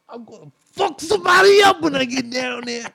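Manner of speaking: loud, high angry holler; so mad. Retold or imitated. Uncle threatening the boys on his way over, so mad. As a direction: bellow a threat as the irate uncle. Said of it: "he's just so mad".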